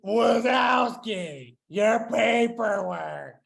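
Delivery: loud, high, very slow clerk impression; Roz. Clerk calling out Wazowski over paperwork, loud Roz impression. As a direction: call it out in a dragged-out monster voice. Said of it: "that was an amazing impression".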